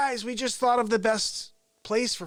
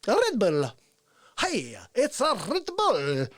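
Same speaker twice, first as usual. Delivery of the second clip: Jim Carrey; 5 semitones higher, 3 dB louder, slower than usual. Retold or imitated. Recalling a comic's drink-name routine, wildly elastic delivery. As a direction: stretch and twist each repeat, manic and cartoonish. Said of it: "Jim Carrey. Be like".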